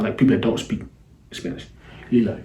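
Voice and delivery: ordinary talk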